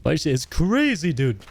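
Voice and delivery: slow, high swoop then drop; imitating a friend. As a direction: mimic your friend, stretching crazy up high. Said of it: "I wonder why I said it like you".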